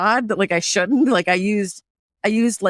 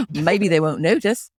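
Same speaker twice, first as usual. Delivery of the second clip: slow, low Aussie aside; performed Tasmanian-leaning Australian accent; 4 semitones lower, slower than usual. Shown as a demonstration. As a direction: confide it slowly in Australian. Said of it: "a bit more Tasmanian".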